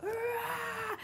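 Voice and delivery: high-pitched mock crowd cheer; crowd scream. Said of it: "Like, imagine screaming".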